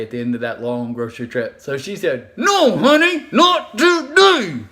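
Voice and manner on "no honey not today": high, slow, strained words without moving lips; through-the-teeth refusal. Acted. Tired mom refusing her child in public, through clenched teeth. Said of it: "talks to you without moving their lips".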